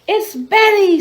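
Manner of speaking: high, loud proud proclamation; joyful announcement. Acted. Queen announcing Betty as the winner, loud and joyful. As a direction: ring it out to the whole ball.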